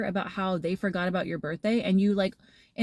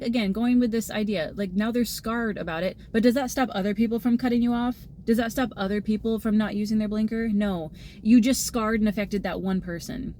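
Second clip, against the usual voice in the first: ordinary talk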